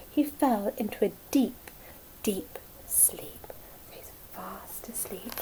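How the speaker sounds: whispered, slowing, sleepy; bedtime whisper. A narrator's reading. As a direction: fade into a hush. Falling asleep bedtime hush.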